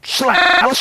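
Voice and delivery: sharp shouted door-slam sound; loud slam. Retold or imitated. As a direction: bark it like a door banging.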